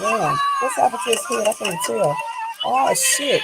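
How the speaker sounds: stunned, loud and high; shocked. Real, not acted. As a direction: gasp out in real shock. Shocked reaction to clip.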